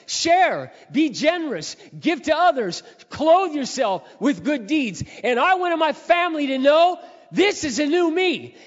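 very high, very loud impassioned shout; fervent shouted testimony, stretching key phrases; full-force preaching. Real, not acted. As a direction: proclaim it at the top of your voice.

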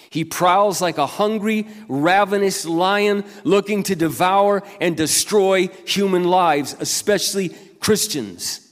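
loud, high, emphatic scripture warning; forceful preaching. Acted. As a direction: sound the alarm to the congregation. Preaches warning about the devil.

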